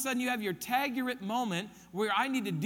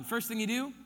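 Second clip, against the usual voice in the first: ordinary talk, nothing marked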